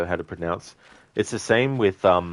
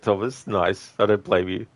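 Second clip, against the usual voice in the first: usual speaking voice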